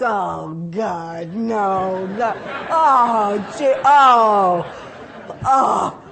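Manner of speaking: mock the whole room groaning in protest; building hammed-up group groan. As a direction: groan building from a mild moan to a loud laughing wail. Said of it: "the whole group goes".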